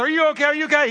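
high, loud worried questions; frantic checking. Retold or imitated. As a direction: check on a lost child in mock panic. Friends frantic on finding Johnny.

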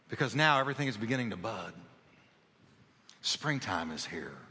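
soft, low intimate preaching; hushed preaching. Acted. Preacher sharing God's word to him, softly hushed. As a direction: lean in and speak softly to the church.

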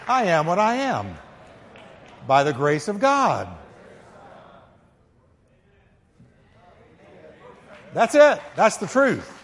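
high, loud pulpit shout; shouted preaching. Acted. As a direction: lift the church with it. Congregation repeats, preacher shouts.

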